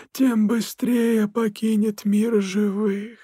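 slow, cold, eerie spirit voice; icy otherworldly voice. A narrator's reading. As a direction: greet the prince like a ghostly king. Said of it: "добавил король сумеречных земель". (ледяной потусторонний голос)

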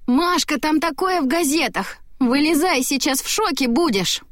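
high-pitched excited brother; urgent, high-voiced wake-up call. Brother calls excitedly through the door.

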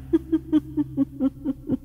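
high, distant ghost-woman cackle; eerie ghostly laugh. A narrator's reading. Ghost woman laughs over the collapsing old man, eerie and echoing. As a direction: laugh eerily, high and far away. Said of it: "Tiếng cười ma mị văng vẳng".

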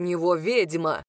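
low, stern order from Arvin; stern command. A narrator's reading. Arvin sternly orders the witch to stop. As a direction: snap the word like a strict master. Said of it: "строго велел Арвин".

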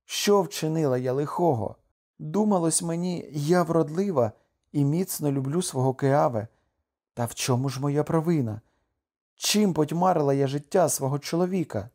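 high-pitched plaintive questioning; distressed wife crying out her questions; lamenting outcry. A narrator's reading. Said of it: "вигукнула Кокуа".

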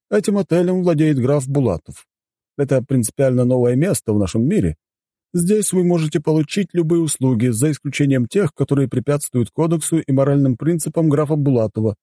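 high-pitched formal butler explaining house rules; courteous butler. Acted. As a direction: present the hotel as its dutiful butler. Voices the butler explaining the hotel. (учтивый дворецкий)

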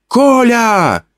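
high calling woman's voice; a woman's high call of "Коля!". Acted. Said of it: "окрикнул меня знакомый женский голос".

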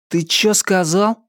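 угрожающий вызов, выше обычного; грозно спросить, набычившись; угрожающе. A narrator's reading. Виктор наезжает на обидчика. (с угрозой)

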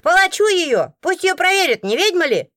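high, loud accusing woman; shrieked demand from an angry onlooker; high-pitched, vindictive heckling; outraged madam. A narrator's reading. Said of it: "это опять та мадам".

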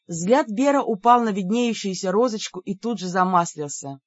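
ordinary delivery

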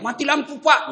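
high yell for the guard; calling out. Acted. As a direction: shout for the warden. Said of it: "masih bisa teriak".

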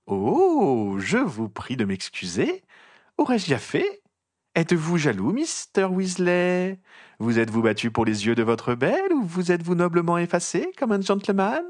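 a syrupy nosy reporter savouring every word; trumpeting gossip journalist. A narrator's reading. Rita Skeeter taunts Harry in front of the mourners, high and mocking.

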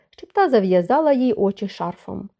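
ordinary delivery, nothing marked

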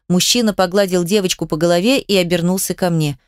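plain reading voice

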